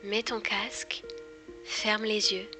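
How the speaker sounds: breathy close-mic hypnosis teaser; right in your ear. Acted. Intro to hypnosis series.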